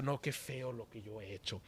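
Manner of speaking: low, hushed lament; softly acted regret; mock remorse. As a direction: imitate a penitent under the breath. Imitates a sinner lamenting his deed.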